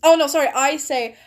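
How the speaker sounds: ordinary talk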